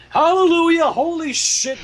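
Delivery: loud, high burst of relief; joyful cry. Real, not acted. Reader cheering as Spike opens his eyes, shouting. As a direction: shout it to the rafters.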